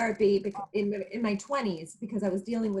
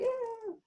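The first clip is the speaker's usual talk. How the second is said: high, breathy shaky little gasp; a scared gasp before speaking; nervous gasp; 12 semitones higher, 5 dB quieter than usual. Retold or imitated. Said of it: "saying it like".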